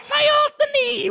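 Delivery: a wild puppet wolf yelling; high puppet voice. Acted.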